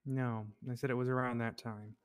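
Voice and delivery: usual speaking voice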